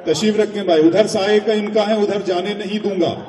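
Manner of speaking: shouting madman blocking the way. Acted. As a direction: bellow it like a crazed host. Madman's words acted out loud.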